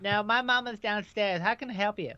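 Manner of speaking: high put-on child voice; squeaky fake voice to dodge a caller; fake phone voice. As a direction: pretend to be a little kid answering the phone. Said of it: "don't do that one".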